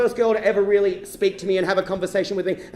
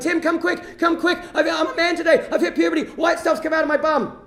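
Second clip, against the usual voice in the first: high-pitched calling out, triumphant; excited yelling; 7 semitones higher than usual. Retold or imitated. Boy calls brother Tim to the toilet, excited yelling. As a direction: call your brother over in high excitement. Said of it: "I yelled out to Tim".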